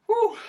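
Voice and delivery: a high, loud exhale of relief; high relieved whew. Real, not acted. Coach after the turbo set, high loud whew of relief. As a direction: blow out a whew, spent.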